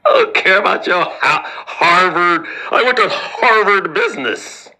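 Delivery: mock annoyed commenter. Harvard grad commenter snapping at the host, mock annoyed. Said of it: "they gonna hit me in the comment section like".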